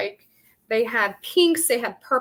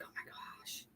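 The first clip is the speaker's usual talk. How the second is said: whisper; 26 dB quieter than usual. Retold or imitated. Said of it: "I'm like".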